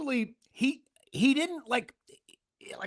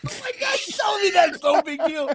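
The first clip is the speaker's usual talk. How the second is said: excited; 7 semitones higher than usual. Retold or imitated. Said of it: "I was like".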